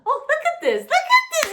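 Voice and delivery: high, loud, laughing exclamation; squealed, gleeful self-mockery; amused outburst. Real, not acted. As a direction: burst out laughing at yourself. Cracks up at own therapist talk.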